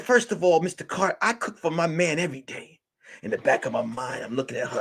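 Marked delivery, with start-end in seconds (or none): 0.0-2.8 high-pitched put-on female retort; mock-offended client, higher throughout; indignant woman client. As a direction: set him straight in a raised woman's voice. Client rebuts detective's question.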